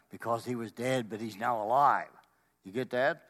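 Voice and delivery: ordinary delivery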